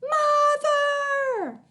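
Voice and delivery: very high loud cry; child calling out. A narrator's reading. Child yells for mother during fight, loud cry. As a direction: scream for Mother.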